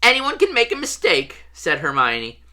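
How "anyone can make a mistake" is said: defensive. A narrator's reading. Hermione defends Lockhart to Ron.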